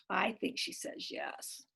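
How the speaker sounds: whispered aside. Real, not acted. Parent whispering a guess to the child. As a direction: breathe the guess, no voice. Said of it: "aside to child, whispered".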